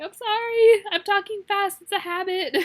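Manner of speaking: apologizing to a coworker for talking fast, hammed-up and flustered; flustered apology. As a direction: blurt the apology out, embarrassed.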